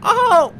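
high, loud cry; startled loud "aha"; alarmed exclamation. Real, not acted. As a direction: let out a sudden high yelp. Reaches the void.

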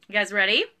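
ordinary delivery

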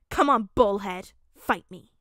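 loud cocky taunt; loud challenge. Acted. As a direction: call him out to fight. Child challenges to fight.

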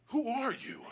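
high-pitched, baffled demand; incredulous challenge. Retold or imitated. Mastiff owner quizzing a stranger, incredulous. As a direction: question a stranger in disbelief.

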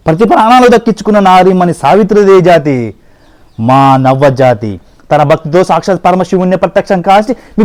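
loud, rolling mythological-film declamation; grand film dialogue. Acted. The human, defending humankind to Yama, declaims loudly like a film hero. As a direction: thunder it like a classic Telugu screen hero. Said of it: "నేను dialogue చెప్తా".

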